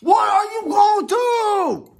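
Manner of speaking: very high, loud crying wail, slow; passionate cry. Shown as a demonstration. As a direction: wail it out like the passion's breaking through. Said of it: "it come out like this".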